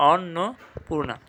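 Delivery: "অন্নপূর্ণা" slowly as অন্-নো-পূর্ণা; correct pronunciation. Shown as a demonstration. Teacher answering own question, "অন্নপূর্ণা" slow and split. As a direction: separate the pieces of "অন্নপূর্ণা". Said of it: "সঠিক উচ্চারণ কী হবে".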